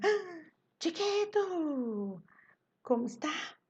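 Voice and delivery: mock scolding, high drawn-out "chiquito"; high-pitched, exaggerated telling-off of a little boy; bronca encenada. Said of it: "se eu estiver brigando com o menino". (bronca fingida, voz aguda e arrastada)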